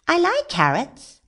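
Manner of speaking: lightly voiced high eager rabbit; rabbit character. Rabbit spotting carrots in the garden, high and eager.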